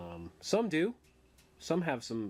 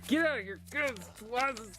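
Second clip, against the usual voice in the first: excited exclamation; 13 semitones higher than usual. Real, not acted. Pulling the insert out, delighted.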